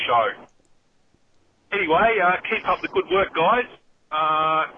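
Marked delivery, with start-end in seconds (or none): none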